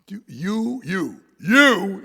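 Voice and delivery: each you punched high and loud; repeated you, hit hard and rising; stress on you. Shown as a demonstration. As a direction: hammer the word you. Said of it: "he emphasizes this".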